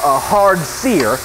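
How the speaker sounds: ordinary talk, nothing marked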